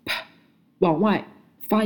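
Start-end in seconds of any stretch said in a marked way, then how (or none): 0.0-0.3 啪 mit fest geschlossenen Lippen, dann plötzlich geöffnet; 啪 als harter, stimmloser Lippenplosiv; p sound. Shown as a demonstration. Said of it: "发音技巧是双唇紧闭".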